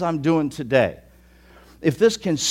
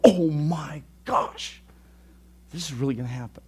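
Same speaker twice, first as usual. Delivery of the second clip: awed whisper; 4 dB quieter than usual. Acted. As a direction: breathe it out in disbelief. Awed listener's realization.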